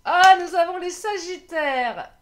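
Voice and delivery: loud, slow triumphant reveal; excited announcement. Real, not acted. Card reader announcing the next sign to viewers, loud and slow.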